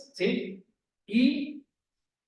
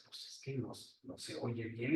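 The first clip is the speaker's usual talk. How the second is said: much softer and lower, mumbling the excuse; mock mumbled excuse; 8 semitones lower, 14 dB quieter than usual. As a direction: murmur the excuse as if half asleep. Listener imagined refusing God.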